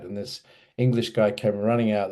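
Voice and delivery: normal talking voice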